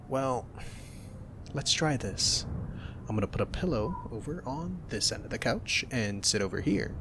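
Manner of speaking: ordinary delivery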